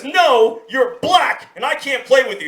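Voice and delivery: high, forceful refusal. Retold or imitated. Boy rejects his playmate a second time, forceful. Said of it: "He's a little forceful with this one".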